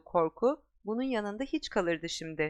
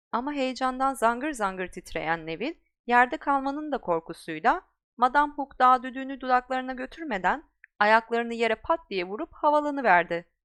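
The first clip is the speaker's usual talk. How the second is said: ordinary delivery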